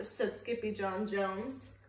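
ordinary delivery

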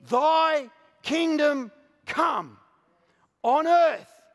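slow, high and loud, proclaiming; proclaimed shout. Acted. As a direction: cry it out over the congregation. Preacher proclaiming the Lord's Prayer.

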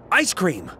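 moderately high, falling exclamation; sudden realization. Acted. Suitor remembers where the meeting is, sudden realization. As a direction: land it as a discovery, not an offer.

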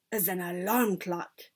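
understated, measured reveal; triumphant announcement. A narrator's reading. Grand High Witch unveiling her secret, understated triumph. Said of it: "announced the Grand High Witch triumphantly".

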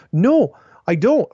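drawn-out, high, loud denial; emphatic retort. Retold or imitated. Acted emphatic reply to critics.

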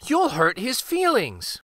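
put-on high, mildly upset child; gently reproachful little girl; protesting cry. A narrator's reading. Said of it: "cried Marie".

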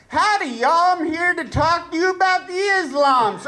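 hammed-up Southern narrator, pitched high; Southern accent. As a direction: chirp it out in a mock Southern twang. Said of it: "narrator pop on in a Southern accent".

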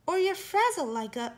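ordinary delivery, nothing marked

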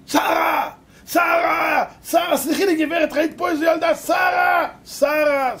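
high, loud calling for someone lost; frantic drawn-out name calling; shouting. Retold or imitated. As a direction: search for her, calling at full voice. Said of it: "היא שומעת פתאום צעקות".

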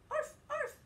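high, loud little arf; puppy yip. Acted. Teacher voicing the little pup for children, loud squeaky yip.

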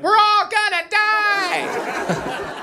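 very high, drawn-out mock wail; panicked daughter. Daughter as the canoe rocks, imitated panicked wail. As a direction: stretch it into a hammed-up doomsday scream. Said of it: "my daughter's like".

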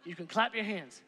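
usual speaking voice

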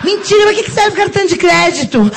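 ordinary talk

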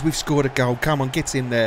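ordinary talk, nothing marked

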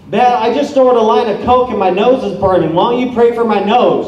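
a mock-cocky dude demanding a prayer; cocky guy. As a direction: brag like a cocky partier. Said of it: "he's just really cocky".

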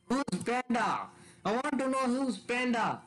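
high, pushy questions; insistent demanding. Real, not acted. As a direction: keep pressing for an answer. Demanding who Panda is.

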